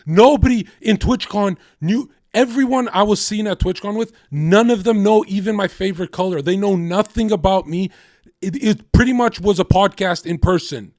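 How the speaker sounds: fired-up defensive rant; heated rant. Real, not acted. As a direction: push back hard and fast. Heated argument with co-host.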